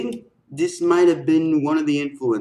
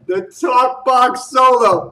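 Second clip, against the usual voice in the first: loud, higher, hyped reaction; amazed exclamation; 6 semitones higher, 5 dB louder than usual. Real, not acted. Reacting to a surprise talk box solo, amazed. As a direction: blurt it out, blown away by the solo.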